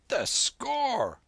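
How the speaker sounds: sudden outburst. A narrator's reading. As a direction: blurt it as it suddenly comes back to you. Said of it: "he burst out".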